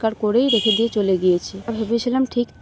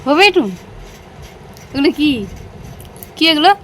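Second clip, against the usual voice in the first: high, loud delighted call; excited calling; 6 semitones higher, 4 dB louder than usual. Acted. Mother calling her baby in high excited tones.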